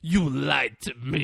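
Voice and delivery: slow, drawn-out mock accusation; sing-out imitation of a caught-you line; Denzel Washington. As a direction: stretch each word like a taunt. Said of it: "Like, just like that".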